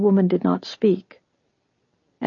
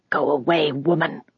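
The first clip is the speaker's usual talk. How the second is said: hissed. A narrator's reading. Elder sending Tamar's mother off, hissing. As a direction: hiss it as a hostile old elder. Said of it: "one of the elders hissed".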